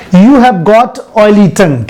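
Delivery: slow model sentence. Shown as a demonstration. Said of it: "बोला जाता है".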